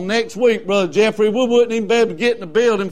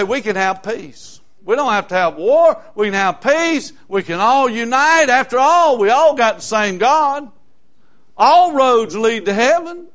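a hint of a smooth peace-preacher; lightly mocking sweet sermon voice; mocking the false preacher; 5 semitones higher, 6 dB louder than usual. Preacher mocking the coming deceiver, mild mock sweetness. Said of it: "he'll say".

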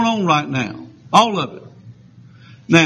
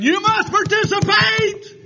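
very high, stretched caricature of a demand; mock shrieked demand. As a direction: shoot way up in pitch, mimicking insistence. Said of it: "but now they're saying".

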